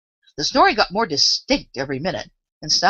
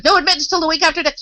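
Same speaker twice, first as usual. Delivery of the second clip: creature doorkeeper; 12 semitones higher, 5 dB louder, faster than usual. A narrator's reading. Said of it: "a creature with a long beak".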